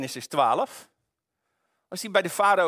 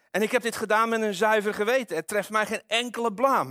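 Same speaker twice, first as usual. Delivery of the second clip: higher-pitched, mildly pleading protest; crying out in protest; 6 semitones higher than usual. A narrator's reading. Abimelech pleads innocence to God, protesting mildly. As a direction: read it as an appeal, pitch raised, volume moderate. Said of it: "riep hij uit".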